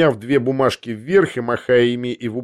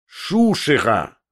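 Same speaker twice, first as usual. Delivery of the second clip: contemptuous cry; much slower than usual. A narrator's reading. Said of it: "прокричал он вновь".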